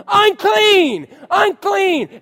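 very high, loud shout; shouting unclean in Walmart; yelling unclean. Pretend. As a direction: yell it out across the store. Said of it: "Just go into Walmart and yell".

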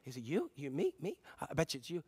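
hushed suspicion. Retold or imitated. Disciples suspecting each other.

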